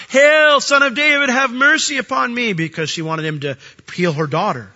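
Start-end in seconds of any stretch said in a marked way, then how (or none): 0.1-2.5 loud, high desperate plea; crying out. Retold or imitated. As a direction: cry out to him for mercy, begging. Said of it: "she's crying out".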